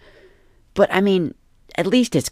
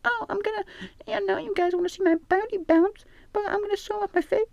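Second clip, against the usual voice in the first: put-on very high, soft coy girl voice; teasing falsetto; 4 dB quieter than usual. Man teasing the face reveal to followers, soft high falsetto. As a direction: tease them in a breathy high voice. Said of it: "you tease the face reveal".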